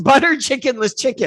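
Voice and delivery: repeats the made-up dish through a laugh; chuckles at her own silly word. Shown as a demonstration. Tickled by her own dish name, laughing through it.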